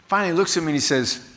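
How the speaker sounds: ordinary talk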